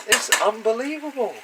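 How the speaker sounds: high, loud, excited exclamation; hyped up. Real, not acted. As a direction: gush with excitement. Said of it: "I don't hype myself up".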